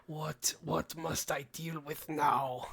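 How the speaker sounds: slow, low, weary voice for Maul; a tired, put-upon sigh of a line; weary groan as Maul. Retold or imitated. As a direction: groan it out like an exhausted villain. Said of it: "He's just like".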